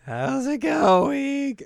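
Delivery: high, drawn-out put-on greeting; hammed-up ailing anime girl; sick character. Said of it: "Very sick".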